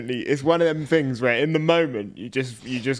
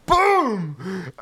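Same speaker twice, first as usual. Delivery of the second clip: triumphant shout; 5 semitones higher than usual. Real, not acted. Celebrating a right answer.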